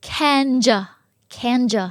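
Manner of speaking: "can you" said as can-juh; linked J sound. Shown as a demonstration. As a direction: turn the y of you into j with a weak vowel. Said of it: "เป็นเสียง j เข้ามา". (can you ออกเสียงเป็น แคนเจอะ)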